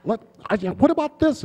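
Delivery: high, loud playful holler; boisterous over-the-top shout; mock loud interruption. Breaking the solemn set mood with a mock shout. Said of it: "And I'm like".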